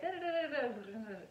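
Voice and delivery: mumbled syllables fizzling out; mumbling. Shown as a demonstration. Coach showing students how speakers mumble at sentence ends, mumbling. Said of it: "that is them mumbling".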